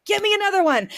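high-pitched and eager; eager request. Retold or imitated. After the cream worked, a high eager plea for another pint. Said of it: "And I'm like".

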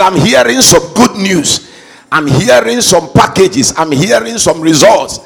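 booming declaration, preacher at full force; fiery preaching. Acted. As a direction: proclaim it to the congregation with all your strength. Congregation repeat, full force.

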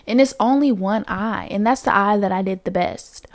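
ordinary delivery

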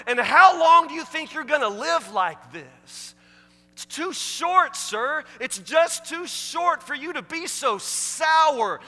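loud, slowing fiery rebuke; preaching at full force. Acted. Preacher scolds the husbands in the pews, fiery and loud. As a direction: hammer it home from the pulpit.

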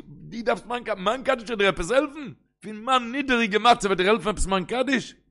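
high-pitched disbelieving questions; incredulous protest. Acted. Acted protest against saying Kaddish. (אומגלויביקער פראטעסט, מיט א הויך קול)

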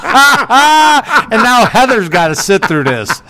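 slow, high words through laughter; real laugh running through the words; laughing while talking. Real, not acted. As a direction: crack up as you carry on telling it. Cracks up while telling story.

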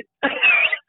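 high squealing laugh; shrill burst of laughter at the punchline. Real, not acted. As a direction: let out a sudden shrieking laugh. Laughing after call cut off.

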